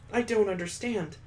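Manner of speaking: a hint of a frightened young woman; Mallory's unease. Mallory confused and scared.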